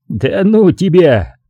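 slow, laughing brush-off with a tear in the eye; half-laughing dismissal. A narrator's reading. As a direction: wave him off while still laughing. Laughing off a joke, wiping a tear.

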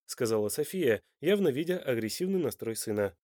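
plain reading voice

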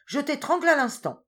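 high, very loud furious yell; raging tiger threatening his wife, shouting high and loud; furious roar. A narrator's reading. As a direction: bellow the threat at full rage. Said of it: "hurla le tigre en fureur".

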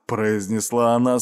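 usual speaking voice

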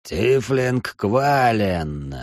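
very slow, drawn-out demonic call; ominous voice. Acted. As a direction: stretch every syllable with menace. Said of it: "Послышался зловещий голос".

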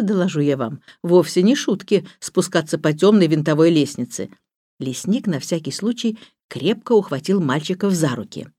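usual speaking voice

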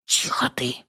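sharp soft hiss of a whisper; hushing whisper. A narrator's reading. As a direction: shush him under your breath. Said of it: "шикает на меня Рудольфовна".

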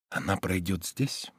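slow, very soft and low; hushed thought. A narrator's reading. Man waits hidden among bushes, hushed. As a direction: think it under your breath, slowly.

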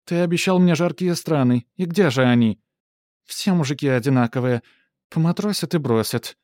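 high, loud, whiny complaint; a spoiled princess nagging. Acted. Принцесса жалуется кавалеру. (капризная принцесса)

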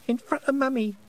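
put-on very high toddler voice; dutiful small child. A narrator's reading. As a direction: recite it as a little child. Small child completes the rule.

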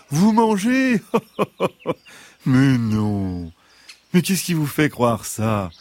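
high fox with a mock-innocent laugh. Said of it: "répondit le renard".

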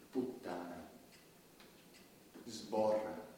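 low, hushed string of obscene words; murmured obscenities. Acted. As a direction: drop each word softly into the dark.

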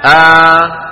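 آ as one long open held vowel; long vowel substitute. Shown as a demonstration. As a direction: sustain آ loudly with no second glottal stop. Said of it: "شو بيقولوا". (آ ممدودة بدل الهمزة الساكنة)

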